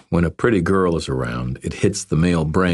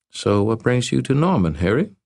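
put-on higher, playful young woman; 7 semitones higher than usual. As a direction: tease him like a Southern belle.

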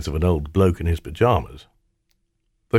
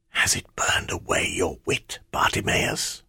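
hushed menacing shadow; dark whisper behind you; 6 dB quieter than usual. Acted. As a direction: breathe it out like a shade. Shadow Ammit speaks.

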